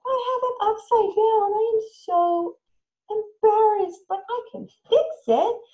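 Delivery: high, loud playful embarrassment; hammed-up embarrassment. Shown as a demonstration. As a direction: overplay being embarrassed, then cheerful fix. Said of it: "You can model it".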